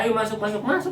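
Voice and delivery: a touch of the eager mother calling him in; mother welcoming her son home, lightly imitated; mother inviting in.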